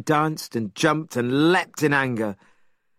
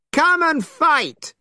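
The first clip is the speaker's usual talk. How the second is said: very high, slow, loud challenge; Monkey daring the dragon, full shout; battle shout; 4 dB louder, slower than usual. A narrator's reading. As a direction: roar it while waving the cudgel. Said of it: "shouted Monkey".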